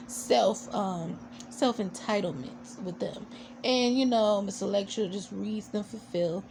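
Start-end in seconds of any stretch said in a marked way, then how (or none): none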